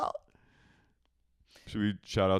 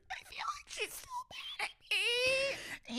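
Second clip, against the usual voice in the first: very high wailing sob, slow; hammed-up falsetto sob; mock crying; 7 dB quieter, slower than usual. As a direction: wail it like a melodramatic crier. Mocking Sheena's crying.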